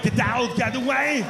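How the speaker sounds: high, urgent mock Schwarzenegger order; movie action-hero. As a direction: yell it like an accented action star. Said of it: "He says that in every movie".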